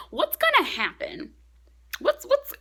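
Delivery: loud, high mock panic; frantic exclamation. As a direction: burst out with cliffhanger frustration. Acts out cliffhanger frustration.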